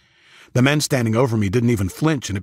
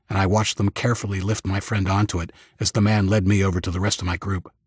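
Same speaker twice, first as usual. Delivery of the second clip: ordinary delivery, nothing marked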